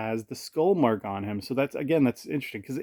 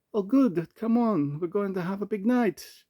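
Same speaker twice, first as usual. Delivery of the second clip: high-pitched mock French student; high, put-on French accent; 9 semitones higher than usual. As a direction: invite them along in a high French accent. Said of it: "it's gotta be a French accent".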